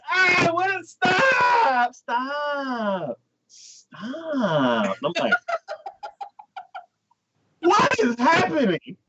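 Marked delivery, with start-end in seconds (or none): 0.1-5.0 hammed-up panicking dog; mock panicked dog. 7.6-9.0 high, slow, crying-toned disbelief; hammed-up bewildered cry; mock shocked wail. Narrator's shocked reaction.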